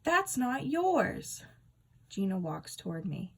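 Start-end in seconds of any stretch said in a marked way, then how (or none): 0.0-1.5 high slow indignant shout. A narrator's reading. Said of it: "I shout".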